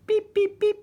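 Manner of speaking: very high, clipped beep beep beep; squeaky machine beeps; high electronic beeps. A narrator's reading. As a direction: imitate a metal detector, tinny and high. Metal detector beeping.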